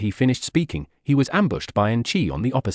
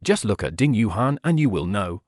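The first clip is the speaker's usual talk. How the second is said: plain reading voice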